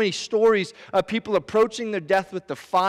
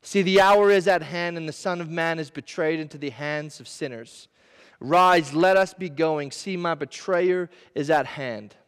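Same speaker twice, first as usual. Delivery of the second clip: lower, measured Bible reading; scripture reading; 5 semitones lower than usual. Acted. Reads scripture passage aloud.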